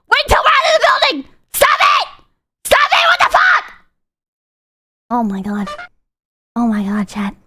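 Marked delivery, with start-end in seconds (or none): 0.1-3.7 higher and higher, ending in a shout; exasperated yell. Retold or imitated. Retelling how he yelled at a mumbling friend, shouting. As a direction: snap at the mumbler to stop. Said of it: "And you're just sitting there like".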